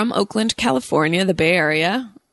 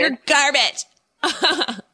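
put-on Valley Girl voice; 5 semitones higher, much slower than usual. Shown as a demonstration. Drawled insult in voice.

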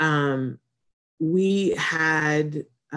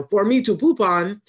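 a quick, half-voiced hint of a rude puppet dog, a bit higher and louder; light Triumph the Insult Dog impression; 5 semitones higher, 6 dB louder than usual. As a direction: toss off the insult dog's catchphrase lightly. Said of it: "the dog's answer for everything is".

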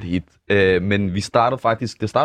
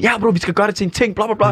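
high, eager hype in a retold quote; hyped up; 11 semitones higher than usual. Friend on the phone agreeing to make it a thing, imitated high and hyped. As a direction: play a buddy all fired up about the idea. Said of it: "han var helt hype".

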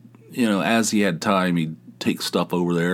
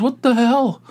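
high-pitched mock indignation; retold outrage; 4 dB louder than usual. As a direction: imitate a seriously pissed host, pitch leaping up. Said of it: "he's, like, pissed".

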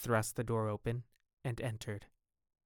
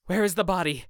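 higher, louder urgent cry; exclaimed; 7 semitones higher, 8 dB louder, faster than usual. A narrator's reading. Dorian exclaims to the gamekeeper, urgent and loud. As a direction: demand it in sudden hope. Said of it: "he exclaimed".

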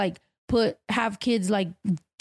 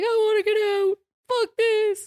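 much higher and louder, wailing; bawling; 12 semitones higher, 3 dB louder than usual. Retold or imitated. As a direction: cry out like a desperate kid in custody. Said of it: "started bawling my eyes out".

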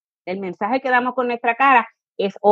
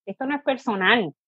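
ordinary talk

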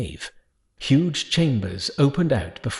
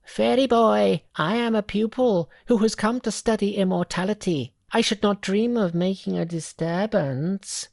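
high, odd little monkey voice; 8 semitones higher than usual. Acted. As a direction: speak as an eager young monkey.

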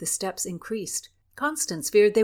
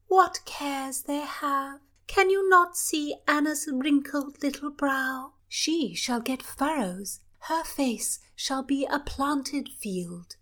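high, slow teasing princess, fading to a whisper; playful royal girl; 7 semitones higher, slower than usual. Acted. Princess teasing about Anna.

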